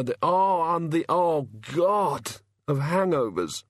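a dazed, hungover god, slow and halting; lightly voiced sufferer, fumbling for words; groggy hungover god. A narrator's reading.

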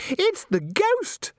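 very high, drawn-out slow shriek. Acted. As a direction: shriek it on cue, a bit by rote. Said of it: "shrieked Christine automatically".

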